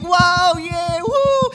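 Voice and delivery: high, loud mock cheer; a crowd's delighted whoop. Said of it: "all the prostitutes and pimps are like".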